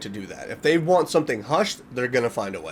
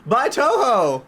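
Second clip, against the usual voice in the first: mocking amused readers; 4 semitones higher than usual. Newspaper readers laughing it off, mock amused. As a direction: scoff at the sighting like it's a publicity stunt. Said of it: "They're probably thinking".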